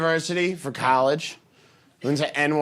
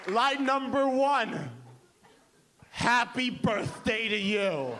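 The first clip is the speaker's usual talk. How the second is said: high, slow, proud announcement; grand unveiling; 6 semitones higher, 5 dB quieter, slower than usual. Acted. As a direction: present each word like a masterpiece. Songwriter grandly unveils first line.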